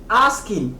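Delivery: "asking" closed on the N sound; silent final G. Shown as a demonstration. Teacher repeating the model, silent final G. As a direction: say "asking" without a hard G. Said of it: "on va dire asking".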